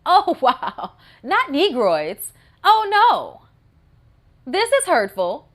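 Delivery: loud, high sarcastic gasp; hammed-up mock hurt; exaggerated fake outrage; mock offense. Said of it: "I was like".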